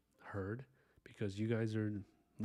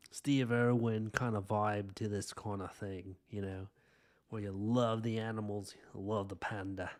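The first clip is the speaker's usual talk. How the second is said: badly faked Aussie accent; Steve Irwin's Australian accent. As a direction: put on a hammy, clumsy Australian twang. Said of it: "that was a terrible accent".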